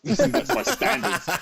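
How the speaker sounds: slow and high, through a laugh; high, slow, self-mocking laugh. Real, not acted. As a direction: laugh it out at yourself. Laughing at himself.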